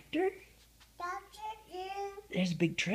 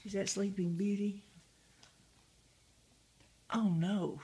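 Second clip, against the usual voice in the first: normal talking voice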